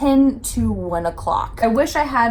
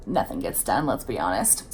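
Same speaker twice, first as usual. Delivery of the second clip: wry aside; 14 semitones lower, 9 dB quieter than usual. Real, not acted.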